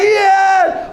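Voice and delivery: high screamed cheer; acted jubilant shriek; crowd cheer. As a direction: yell it with the whole crowd. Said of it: "Everyone's going".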